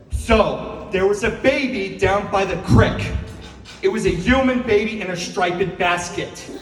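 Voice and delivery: emphatic mom retelling with odd pronunciations; "human" as "yooman", milk as "malk", in mom's voice; mom's storytelling voice. Acted. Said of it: "tell you that story how my mom would tell you".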